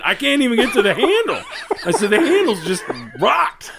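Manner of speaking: high, laughing, half-choked words; laughing disbelief. Retold or imitated. Describing the ruined stall to his buddy, laughing high. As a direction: giggle through the horror.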